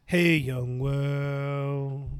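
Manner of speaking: slow, loud stretched greeting; drawn-out call. Pretend.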